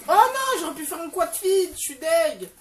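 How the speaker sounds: crier sa déception très haut et fort; dépité. Real, not acted. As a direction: lamentation aiguë et forte. Missed quad feed in game. (cri de déception)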